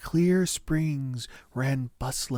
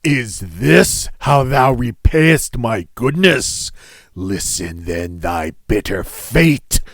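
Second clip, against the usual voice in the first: savage monster roar; 2 semitones lower, 4 dB louder than usual. Acted. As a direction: roar at the thief like a wronged beast. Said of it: "the monster roared in a savage voice".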